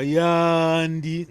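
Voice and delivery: "Yandi" with a long stretched vowel. Shown as a demonstration. Teacher models "Yandi" for student with a long vowel. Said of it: "vogal longa".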